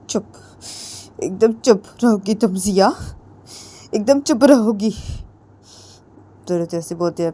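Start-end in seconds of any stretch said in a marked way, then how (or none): 0.1-4.9 angry hush. Acted. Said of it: "वो बोलती कि".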